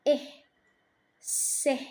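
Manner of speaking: "إه" then a voiceless "سه" for the short i; short i sound. Shown as a demonstration. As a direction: isolate the short i in "إه" and "سه". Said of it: "صوت ال I القصير".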